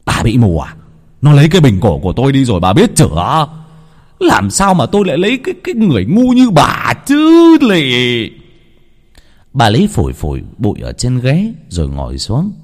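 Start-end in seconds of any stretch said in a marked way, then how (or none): 0.0-8.3 high, loud, spat-out contempt; furious insult. Acted. Husband mocking his wife as stupid, furious contempt. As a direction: rush it, then drag out the insult.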